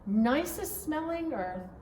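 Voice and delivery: plain reading voice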